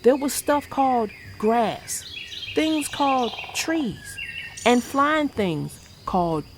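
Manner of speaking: put-on gentle rhyming read-aloud for kids; storybook reading. A narrator's reading. Vlogger reading a bedtime rhyme to kids, storybook voice. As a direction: read the picture book to young viewers. Reads picture book aloud.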